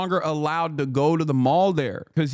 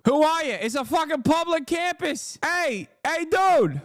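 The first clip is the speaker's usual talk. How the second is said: high Boston heckler voice, "Who are ya?" thrown out; mock Boston accent; 13 semitones higher than usual. Host mocking both Boston guys, high-pitched Boston accent. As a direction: do a pitched-up Boston tough guy. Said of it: "the masshole accent".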